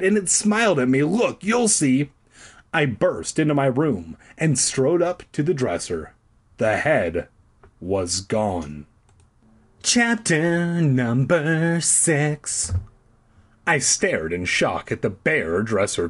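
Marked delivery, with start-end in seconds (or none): 0.0-2.1 urgent cry. A narrator's reading. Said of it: "I cried". 9.8-12.7 chapter announcement. A narrator's reading.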